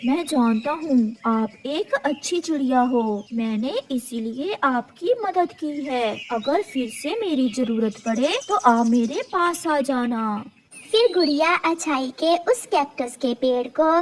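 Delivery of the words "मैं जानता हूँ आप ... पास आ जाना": put-on kind old cactus tree. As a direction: soothe the frightened little bird. Cactus answers kindly.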